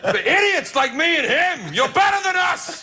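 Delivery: high, excited Pacino impression with laughter breaking in; hammed-up Pacino. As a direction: gush like a theatrical Pacino. Pacino praising the comedian.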